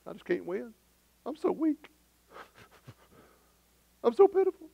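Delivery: high, whiny voice of self-pity; mock pity party. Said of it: "We have our pity party".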